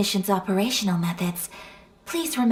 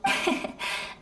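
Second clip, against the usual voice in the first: soft breathy laugh; 14 semitones higher, 6 dB quieter than usual. Acted. Polite laugh at a joke.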